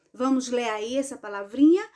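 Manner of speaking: normal talking voice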